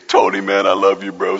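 low-pitched, exaggerated tearful greeting; mock crying. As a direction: pretend to choke up greeting a buddy. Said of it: "we have to cry every time we see".

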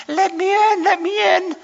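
very high, thin mock plea; mamby-pansy plea. Preacher mocking a timid Jesus asking to come in, high and thin. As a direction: mimic a weak, timid knocker. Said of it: "mamby-pansy".

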